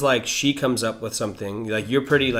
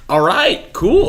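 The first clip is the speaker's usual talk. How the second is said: rising up higher and louder into an incredulous cool; mock surprised acceptance; 10 semitones higher, 7 dB louder, much slower than usual. Friend mocks his own stunned reaction to their move, incredulous and high. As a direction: act caught off guard but roll with it, voice leaping high on cool.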